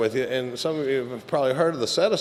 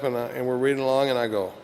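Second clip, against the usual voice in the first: normal talking voice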